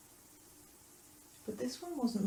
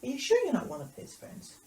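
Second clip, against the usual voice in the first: high, swooping sceptical question; suspicious questioning; 6 semitones higher, 4 dB louder than usual. Acted. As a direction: press them with open doubt. Questioner presses Peter, acted.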